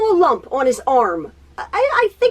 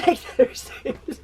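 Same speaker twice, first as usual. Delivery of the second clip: laughing through words; 2 semitones higher, 5 dB quieter than usual. Real, not acted. Quoting husband, laughing.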